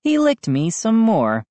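ordinary delivery

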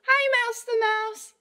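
high cartoon mouse for kids; squeaky mouse voice. Voicing animals in picture book.

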